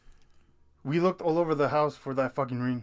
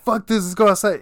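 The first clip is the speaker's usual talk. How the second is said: loud, higher retold shout; 6 semitones higher, 8 dB louder than usual. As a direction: call it out, spooked and bold. Fleeing scary noise with friend.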